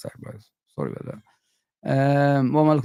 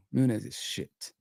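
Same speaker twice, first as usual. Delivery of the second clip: "Nunes is shit" said softer and slow, like a resigned verdict; quiet, slow repeat; 4 semitones lower, 7 dB quieter, much slower than usual. Real, not acted. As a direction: drop "Nunes is shit" into a soft, slow aside. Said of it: "I'm sorry to say that".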